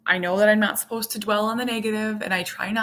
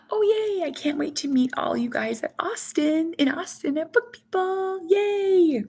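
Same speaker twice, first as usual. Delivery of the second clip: thrilled squeal; 9 semitones higher than usual. Real, not acted. Gasps at news, thrilled.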